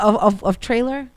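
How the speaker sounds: normal talking voice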